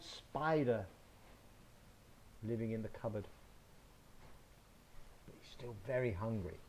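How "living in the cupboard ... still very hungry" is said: usual speaking voice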